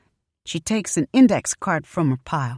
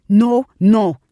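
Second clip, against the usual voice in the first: loud, higher, firm boss-lady no; bossy refusal; 4 semitones higher, 9 dB louder than usual. A narrator's reading. Shop owner cutting off Mama, loud bossy refusal. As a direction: shut her down like a boss. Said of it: "She sounded like her boss lady".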